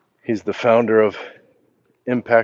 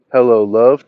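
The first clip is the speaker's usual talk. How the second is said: hearty hello love; 2 semitones higher, 10 dB louder than usual. Retold or imitated. Reads a viewer's greeting to another.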